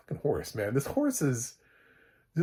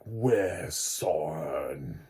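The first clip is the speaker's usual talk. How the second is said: very slow, lower, looming growl; slow monstrous voice; giant creature voice; 6 semitones lower, much slower than usual. Retold or imitated. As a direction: drag out the words like a huge monster. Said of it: "and he's like".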